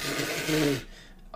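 sputtering laugh into the mic; spit take. Real, not acted. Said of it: "I just spat all over my mic".

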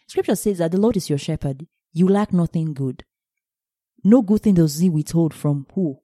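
ordinary talk, nothing marked

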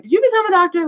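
high, loud eager question; excited question. Retold or imitated. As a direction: ask it bright and eager. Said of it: "she was just like".

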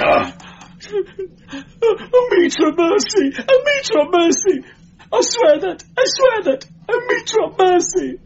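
very high, loud terrified wailing; screamed plea. A narrator's reading. Strangled clerk begging the guardsman, screaming. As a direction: scream for mercy, crying. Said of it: "screamed the wretch".

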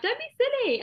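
high breezy mum dismissal. Retold or imitated. Mum waving off daughter's apology, high breezy voice. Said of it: "And she was like".

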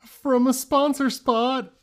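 high, loud, drawn-out jingle-like announcement; mock announcer. Podcast host introduces a wished-for sponsor, mock announcer. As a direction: stretch it out like a hammy ad intro.

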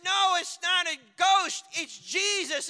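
loud, urgent correction; excited calling out. Acted. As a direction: call it out over a storm. Acted argument among frightened disciples.

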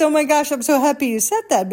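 a friend thrilled to be understood; mock-excited girlfriend, high and loud. Friend's delighted reply retold.

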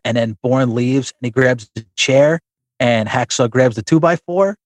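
normal talking voice

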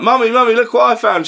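put-on high, loud little kid calling out; excited child. Timmy running in to show mummy, high excited child. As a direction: squeal it like a proud small boy. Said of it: "he's like".

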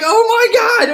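very high, loud mock horror; imitated shriek of horror; horrified scream. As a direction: scream it in comic shock. Owners' horror acted out.

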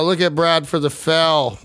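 usual speaking voice

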